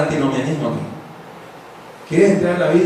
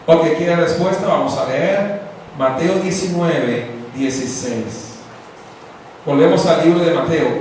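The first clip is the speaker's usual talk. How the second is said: ordinary talk, nothing marked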